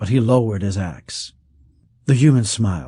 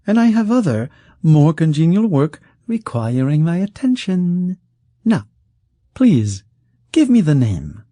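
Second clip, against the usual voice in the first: silky rich man, mock hurt; 8 semitones higher, 3 dB louder than usual. Rich man chiding Kesk, high and silky. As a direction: savour the sour words, then turn velvet-threatening.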